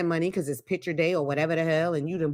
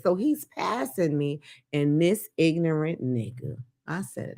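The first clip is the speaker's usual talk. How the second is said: ordinary delivery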